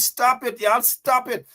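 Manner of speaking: ordinary talk, nothing marked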